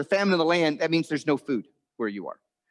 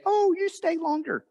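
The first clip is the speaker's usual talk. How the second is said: very high singsong mock hospitality; exaggerated, put-on pleading; insincerely polite. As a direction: squeak out an invitation you don't mean. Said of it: "It's just polite. You're supposed to say".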